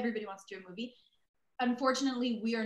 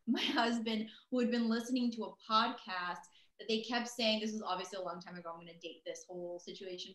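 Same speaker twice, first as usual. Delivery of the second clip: ordinary delivery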